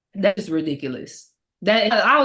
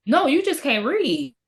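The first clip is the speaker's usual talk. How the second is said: high, drawn-out dismissal; teasing put-down; 7 semitones higher, slower than usual. Real, not acted. Talking back to the girl who insulted Hurston, teasing put-down. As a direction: dismiss her with stretched words. Mock rebuke of absent disrespectful girl.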